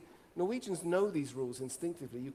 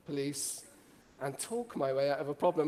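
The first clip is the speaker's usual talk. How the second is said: ordinary talk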